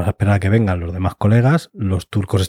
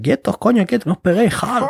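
much higher frantic commands; frantic order; 11 semitones higher than usual. Retold or imitated. Officers shouting at their brawling men, high frantic orders. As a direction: beg and order them to stop, high and urgent.

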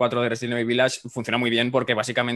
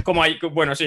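ordinary delivery

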